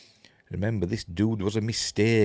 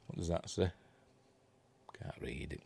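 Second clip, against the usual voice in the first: whisper; 4 semitones lower, 15 dB quieter, faster than usual. Real, not acted. Squinting at small on-screen text.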